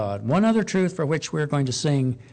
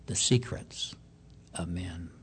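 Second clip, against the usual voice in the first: a preacher whispering of the secrets of men; hushed whisper; 7 semitones lower, 10 dB quieter than usual. Acted. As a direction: whisper the secrets, fading away. Dropping to whisper on judgment.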